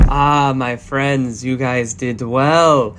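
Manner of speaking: slow, drawn-out charmer; slow smooth voice. Acted. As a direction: savor each word slowly. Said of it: "and is just saying".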